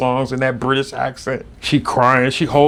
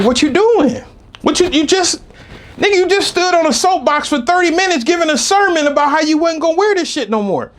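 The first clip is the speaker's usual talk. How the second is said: high, loud incredulous shout; disbelieving yell; 4 dB louder than usual. Retold or imitated. Confronting girlfriend on the stairs, high loud yell. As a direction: yell at her in total disbelief.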